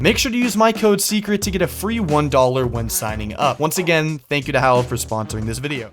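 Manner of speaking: loud, high-energy sponsor read. Acted. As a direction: hype it like a commercial.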